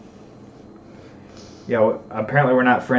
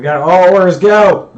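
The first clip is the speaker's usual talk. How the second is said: loud rallying call; shouting; 9 semitones higher, 17 dB louder, slower than usual. Real, not acted. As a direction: yell go to the team. Calling the team into battle.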